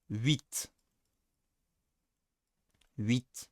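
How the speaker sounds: soft "huit" with a French ui glide; French pronunciation. Shown as a demonstration. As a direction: say "huit" gently, French-style. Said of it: "And also, oui".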